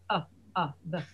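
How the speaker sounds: ordinary delivery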